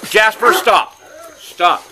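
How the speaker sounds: loud, high, sharp order; firm dog command. Real, not acted. Owner ordering Jasper to stop, firm shout. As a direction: call the dog to a halt.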